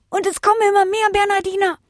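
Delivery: high, sustained wail of alarm; panicked cry. A narrator's reading. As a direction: cry the warning out on a high pitch.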